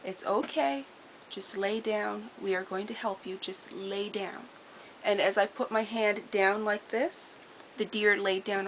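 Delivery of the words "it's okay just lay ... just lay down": calm and soothing. Retold or imitated. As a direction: gently talk a panicked deer down. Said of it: "told the deer in a very calm manner".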